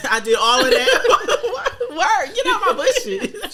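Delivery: talking through giggles; laughing while talking. Real, not acted.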